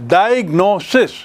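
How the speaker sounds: डायग्नोसिस broken into distinct beats; syllable by syllable. Shown as a demonstration. Teacher counting vowels, डायग्नोसिस split syllable by syllable. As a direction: sound out each syllable of डायग्नोसिस. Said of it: "four times".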